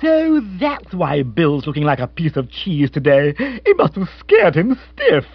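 gratified exclamation. A narrator's reading. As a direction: exclaim like someone who has solved a mystery. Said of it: "uttered the gratified exclamation".

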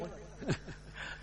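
quiet breathy chuckle under the breath; soft laugh. Real, not acted. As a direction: let a soft laugh slip out. Laughs after telling of the death. (tiếng cười khẽ)

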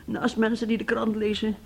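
ordinary talk

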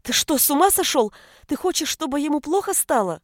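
high-pitched, alarmed mother's cry; outraged shout. A narrator's reading. Mother confronting husband over force-feeding, shouting. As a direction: shout in protest to protect the child. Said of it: "и закричала".

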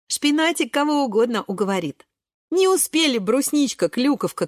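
usual speaking voice

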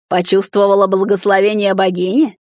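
loud, mocking question; smirking taunt. A narrator's reading. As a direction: ask with a sneering smirk. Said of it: "усмехнулась Эстера".

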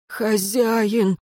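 plaintive, drawn-out plea; pitiful call. A narrator's reading. Dead servant calls his master, pitiful. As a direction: call out piteously. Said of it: "жалобно позвал мертвец".

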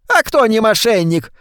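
exclaimed. A narrator's reading. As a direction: exclaim it at her husband. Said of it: "воскликнула миссис Шефер". (воскликнула)

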